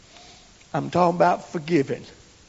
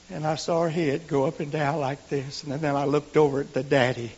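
ordinary talk

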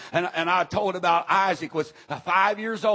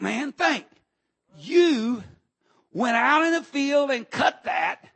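raised, rising rebuke; rebuking preacher; 7 semitones higher, 4 dB louder, slower than usual. Retold or imitated. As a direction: scold him, louder and higher. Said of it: "The man of God said".

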